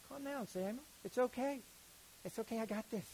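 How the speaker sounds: gently calm an upset prophet; reassuring God. Retold or imitated. God soothing an upset Samuel, gently.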